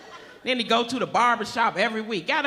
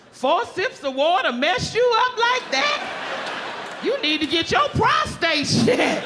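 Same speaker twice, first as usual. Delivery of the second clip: mocking retort; 8 semitones higher, slower than usual. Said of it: "I said".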